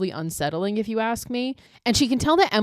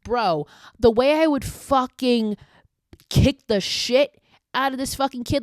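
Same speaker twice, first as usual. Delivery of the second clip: higher and louder, fuming rant; angry outburst; 6 semitones higher, 4 dB louder than usual. Real, not acted. Viewer raging at the creepy girl on screen, angry outburst. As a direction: let the outrage boil over.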